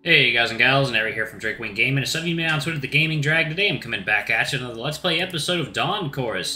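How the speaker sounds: normal talking voice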